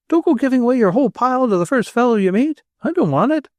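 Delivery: high, fast protesting refusal; young Tenburum voice. A narrator's reading. Tenburum refusing the stranger's whole pile, fast high protest. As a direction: push the money back quickly.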